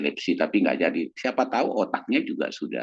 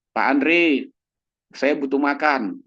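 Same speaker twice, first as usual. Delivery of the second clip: a hint of an insistent old lady, higher; demanding old patient; 8 semitones higher, 4 dB louder, slower than usual. Old diabetic patient asks Pak Andre for food, lightly voiced and higher. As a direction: ask for food like a needy patient.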